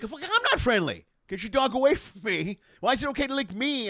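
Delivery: high, loud mock-indignant outburst; imitating an angry outburst. Telling off a dog owner on the beach, high loud mock outrage. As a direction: snap back at the dog owner in hammed-up outrage. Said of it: "And I'm like".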